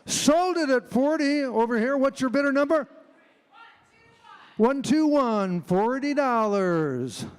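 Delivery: fast rhythmic auction chant; auctioneer. Acted. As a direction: rattle off bids, loud and high. Auctioneer bid calling.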